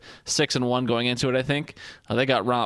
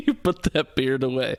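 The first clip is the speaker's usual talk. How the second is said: laughing repeat. Real, not acted. Repeating friend's line laughing.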